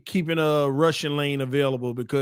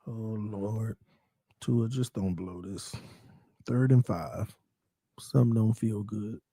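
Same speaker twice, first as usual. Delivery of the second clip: low, soft, anxious murmur; nervous hush; 8 semitones lower, 8 dB quieter than usual. Real, not acted. Fan pleading before third and five, nervously hushed. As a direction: drop low and quiet with dread.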